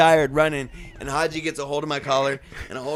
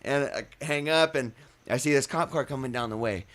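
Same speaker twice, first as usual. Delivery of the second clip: ordinary delivery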